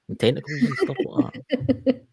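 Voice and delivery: laughing at the silly alien; laughing high. Acted. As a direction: giggle it out high and slow. Teasing about alien creatures.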